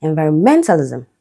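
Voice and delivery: "Environmentalism" stressed on MEN; fourth-syllable stress. Shown as a demonstration. Teacher showing an exception, fourth-syllable stress. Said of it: "Fourth syllable".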